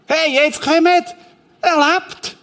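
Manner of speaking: high-pitched amazed call; excited witness. Acted. Witness calling the others because Lazarus lives, excited. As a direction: call the others over, thrilled and astonished.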